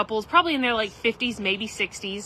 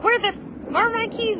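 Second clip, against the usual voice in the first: high, put-on voice of a furious old lady; mock the confused lady demanding her keys; imitating angry old lady; 12 semitones higher than usual. Retelling the old lady who lost her keys, high mock anger. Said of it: "she was really pissed".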